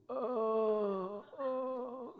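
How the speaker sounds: high drawn-out moaning groans; a hammed-up full-belly moan; mock moan. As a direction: groan like an overstuffed sufferer. Moaning in bed, overfull.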